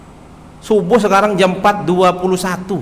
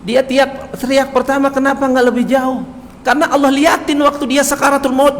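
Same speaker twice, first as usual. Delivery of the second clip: rising fervour; 7 semitones higher than usual. Real, not acted. Preacher building emotional climax.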